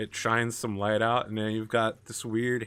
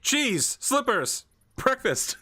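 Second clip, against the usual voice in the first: high, mock-earnest word list; random shouted words; 10 semitones higher, much slower than usual. Halfling yelling random words at kobolds, high mock. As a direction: blurt nonsense words up high. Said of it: "you're like".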